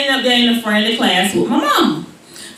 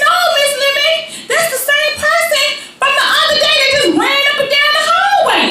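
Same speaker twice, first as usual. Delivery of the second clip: high, fast innocent lie; hammed-up sweet denial; innocent child; faster than usual. Girl denying to teacher, high and fast.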